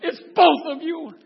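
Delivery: loud, high, drawn-out wail; bawling mother. Retold or imitated. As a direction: imitate mom wailing through her tears. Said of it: "she's bawling her eyes out".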